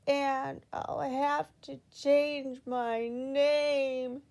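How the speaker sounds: slow, drawn-out mock sob; sobbing. A narrator's reading. Miss Giggles sobbing over losing her giggle. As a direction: wail it like a child about to cry. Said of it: "she sobbed".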